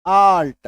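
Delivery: आर्ट said alone, loud; careful teacher's demo; demo word. Shown as a demonstration. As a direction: model आर्ट with a long open a. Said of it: "ठीक है".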